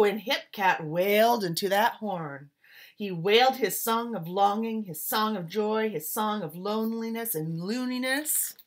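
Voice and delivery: put-on lively rhythmic read-aloud, like reading to children; story narration. A narrator's reading. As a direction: swing the rhymes like a jazz storyteller. Reads picture book aloud.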